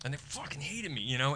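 ordinary talk, nothing marked

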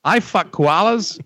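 mock Australian; Australian accent. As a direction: echo it like an Aussie bloke. Australian impression repeated.

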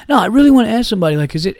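fast, high, loud insistent whine; whiny drunk. Retold or imitated. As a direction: rush it like a pushy drunk.

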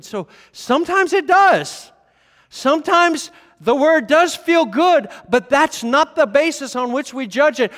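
loud, high, driving pulpit voice; full-force preaching. Acted. Pastor preaching to his church at full force. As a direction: drive it home to the congregation.

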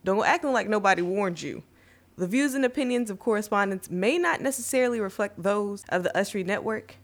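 ordinary delivery

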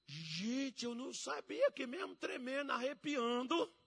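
high, drawn-out mock astonishment; amazed, trembling. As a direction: marvel in a hammed-up tearful pitch. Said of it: "eu falei".

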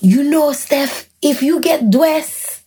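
Haitian mom voice, pitched high, drawing out words; heavy Haitian accent. Retold or imitated. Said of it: "in her very heavy Haitian accent".

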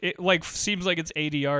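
usual speaking voice

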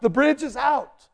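warning a driver at the roadside, shouting; urgent shout. Retold or imitated. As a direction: stop them going further.

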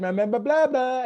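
high French-style gibberish; playful fake-French blather; mock French accent. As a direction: babble in imitation French. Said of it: "in a kind of French accent".

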